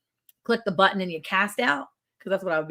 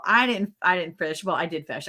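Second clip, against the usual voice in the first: ordinary talk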